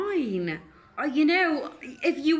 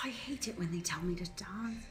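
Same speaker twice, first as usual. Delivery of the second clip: bitter whisper, much softer; resentful whisper; 5 semitones lower, 14 dB quieter than usual. Acted. The dancer complains about being ordered to dance, whispering. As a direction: hiss it under your breath while dancing. Resentful aside while forced dancing.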